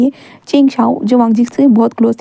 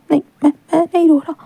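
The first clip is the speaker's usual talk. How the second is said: tearful denial; 5 semitones higher, faster than usual. A narrator's reading. Crying boy denies tears again.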